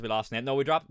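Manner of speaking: ordinary delivery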